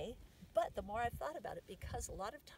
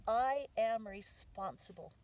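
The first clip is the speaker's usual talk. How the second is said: "I" leaned on, loud and slow; stress on I; 2 semitones higher, 6 dB louder, slower than usual. Shown as a demonstration. Teaching the physical affirmation, stress on "I". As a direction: hit the word "I" firmly, then finish slowly. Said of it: "the emphasis is on the I".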